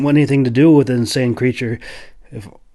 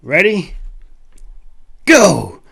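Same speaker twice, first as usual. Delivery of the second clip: shout; 6 semitones higher, slower than usual. A narrator's reading. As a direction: yell the signal. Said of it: "Jack shouted".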